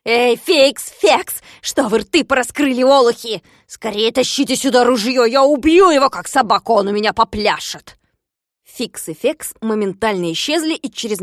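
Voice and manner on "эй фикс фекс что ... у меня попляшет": loud, higher raging commands; furious orders. Acted. Klops demanding a gun, furious yelling.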